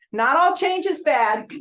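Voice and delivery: slow, high and loud emphasis; emphatic. Real, not acted. Speaker tells agents change can be good, emphatic and slow. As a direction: drive the point home slowly. Closing point to the audience.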